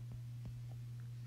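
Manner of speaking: soft breathy laugh under the breath; stifle a laugh at his own joke. Real, not acted. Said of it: "I'm sorry".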